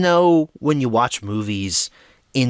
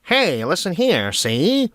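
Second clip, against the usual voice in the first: put-on higher-pitched, swooping old-timey wise guy; hammed-up vintage movie patter; 1950s movie voice; 5 semitones higher, slower than usual. Shown as a demonstration. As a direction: put on a fifties film tough-talker. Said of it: "kind of voice".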